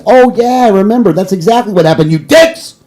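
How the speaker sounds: real anger, voice leaping high; angry rant boiling over into drawn-out swearing; mad outburst. Real, not acted. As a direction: build to a high, exasperated curse, stretching the insult. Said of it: "I'm mad right now".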